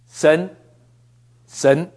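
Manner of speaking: ordinary talk, nothing marked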